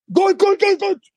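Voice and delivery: high-pitched bulldog-like grandmother; mock yelling. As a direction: imitate a charging bulldog's shouts, very high. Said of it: "og roper". (etterlignet roping som en bulldog)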